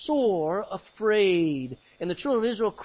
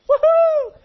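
high, loud cheer of joy; joyful woohoo shout; excited whoop; 10 dB louder than usual. Retold or imitated. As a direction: whoop like a freed crowd. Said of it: "They're excited".

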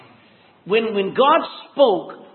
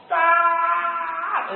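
shout; 9 semitones higher than usual. Shown as a demonstration. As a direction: bellow it at the storm with all your force. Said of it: "I'd go".

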